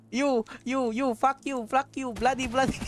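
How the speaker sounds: high-pitched mock Indian accent; angry Indian man. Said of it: "a very angry Indian man says".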